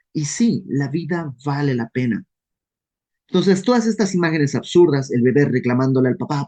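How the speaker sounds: ordinary talk, nothing marked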